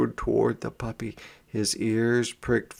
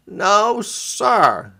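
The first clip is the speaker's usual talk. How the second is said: put-on high, loud fierce boy's no sir; fierce refusal; 10 semitones higher, 5 dB louder than usual. A narrator's reading. Boy fiercely refuses his sister's offer. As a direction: flatly refuse him. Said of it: "said James fiercely".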